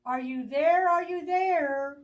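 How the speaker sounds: loud high calls into the wind; calling out. Acted. Friends searching for Bear, calling out. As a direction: call for him across the forest. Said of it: "They call".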